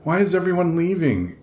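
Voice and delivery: high, broken wail; between sobs. A narrator's reading. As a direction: catch on the first word, then plead. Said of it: "It comes out between sobs".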